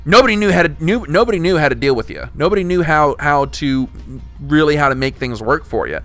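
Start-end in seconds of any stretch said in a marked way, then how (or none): none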